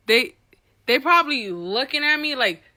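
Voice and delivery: normal talking voice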